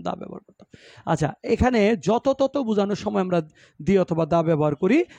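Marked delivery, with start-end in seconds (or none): 0.0-0.7 unvoiced, hushed self-correction; whispered correction. Real, not acted. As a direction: correct yourself under your breath. (ফিসফিস করে নিজেকে শুধরে নেওয়া)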